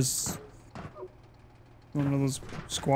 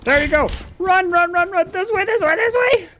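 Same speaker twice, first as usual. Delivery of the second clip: high, loud frantic shouting; being chased; 6 dB louder than usual. Real, not acted. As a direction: yell directions while running away. Fleeing monster, leading friend.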